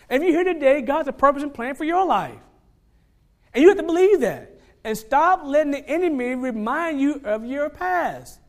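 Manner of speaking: loud, high, driving sermon climax; full-force preaching. Real, not acted. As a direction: preach it out to the whole church.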